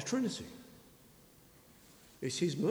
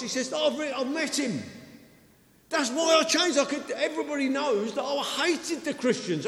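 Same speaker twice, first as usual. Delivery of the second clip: raised, higher and louder preaching voice; full-force preaching; 10 semitones higher, 9 dB louder than usual. Acted. Preacher telling the congregation about Paul, fervent and raised. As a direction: preach it out with fire to the hall.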